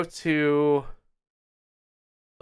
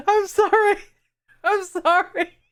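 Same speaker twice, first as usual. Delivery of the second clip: squeaky high filtered apology; sorry through a voice changer; high voice effect; 4 dB louder, much slower than usual. Real, not acted. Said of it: "all my effects were on there".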